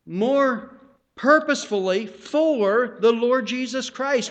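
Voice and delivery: high, loud, slow pulpit build; full-force preacher's exhortation; fiery preaching. Acted. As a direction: drive it home to the congregation.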